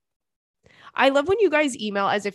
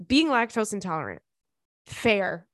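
ordinary talk

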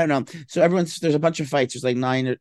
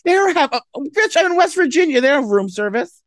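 much higher and louder, disbelieving; exasperated outburst; 14 semitones higher, 6 dB louder than usual. Real, not acted. Told to order mimosas, shrieking back in disbelief. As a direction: blurt it out in disbelief.

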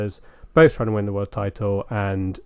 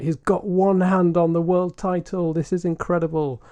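commentator impression; 8 semitones higher than usual. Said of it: "James Allen ... He's like".

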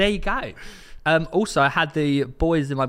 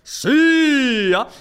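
gleeful echo; 8 semitones higher, 5 dB louder than usual. Real, not acted. Echoing the triumphant shout.

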